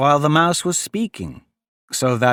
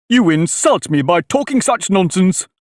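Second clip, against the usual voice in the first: higher, indignant scolding; offended; 7 semitones higher, 4 dB louder than usual. Acted. Mouse walks away from Alice, offended and indignant. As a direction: take great offence, emphatically.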